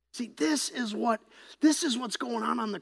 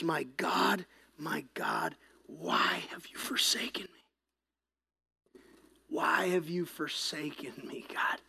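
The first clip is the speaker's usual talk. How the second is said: hushed, slow whisper of Jesus's cry; a broken whisper from the cross; anguished whisper; 6 semitones lower, 9 dB quieter, slower than usual. Acted. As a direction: breathe it out, forsaken and soft.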